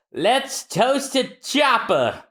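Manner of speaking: slow, shouted toast; slow cheer to the whole ship. Acted. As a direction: raise a glass and call it out. Crew toasting the new doctor.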